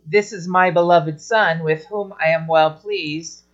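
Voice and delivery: ordinary delivery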